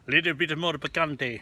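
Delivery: put-on a light, jokey Spanish accent on "picante", said as pi-KAN-te; slight put-on Spanish accent for a beer name; mock Spanish accent. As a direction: toss off "picante" in a half-hearted Spanish accent. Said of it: "I don't know what kind of accent that was".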